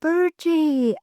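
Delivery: a high, retold holler of the name "Virgie!". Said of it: "and he hollered".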